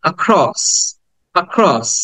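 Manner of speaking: "অ্যাক্রস" with barely a quarter-length "আ" at the start; "অ্যাক্রস" with a tiny first vowel and stressed second syllable; very short schwa. Shown as a demonstration. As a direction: model "অ্যাক্রস" as "uh-KROSS". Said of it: "চার ভাগের এক ভাগ উচ্চারণ করতে হয়".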